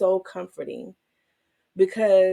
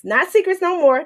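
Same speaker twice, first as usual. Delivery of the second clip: higher and louder, teasing; bright, sassy burst; playful exclamation; 9 semitones higher, 8 dB louder than usual. Real, not acted. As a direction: tease the listeners with a grin. Playful correction to listeners.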